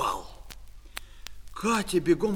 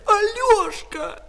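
very high, loud, long-held yell; angry shout; 7 dB louder, slower than usual. Acted. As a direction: scream for your brother, outraged. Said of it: "закричала Катя".

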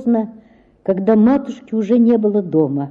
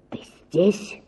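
беззвучный шёпот «Ты здесь?»; прошептать тайком; шёпотом; 2 semitones lower, 7 dB quieter than usual. A narrator's reading. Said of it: "сказал он шёпотом". (отец шёпотом на тёмной лестнице)

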